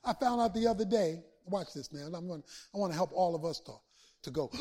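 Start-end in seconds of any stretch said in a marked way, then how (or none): 4.2-4.5 awed gasp. Shown as a demonstration. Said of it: "made you go, "Wow"?".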